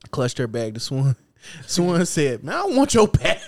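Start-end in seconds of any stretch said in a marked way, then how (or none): 2.4-3.4 high, loud mock protest; retold loud retort. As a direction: act out an indignant retort, loudly. Retold retort to bag clutcher.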